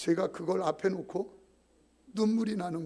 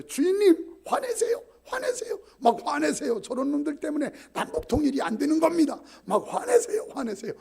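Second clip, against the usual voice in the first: very high, loud goading cry; high squealing voices egging him on to rage; cells urging anger; 10 semitones higher, 8 dB louder than usual. Retold or imitated. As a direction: shriek at him to get angry, hammed up. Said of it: "저한테 막 외치는 거예요".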